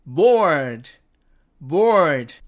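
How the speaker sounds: BORED with the r kept; American bored variant. Shown as a demonstration. Teacher showing the other American way, BORED with r. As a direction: sound the r fully in BORED. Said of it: "R자를 살려서".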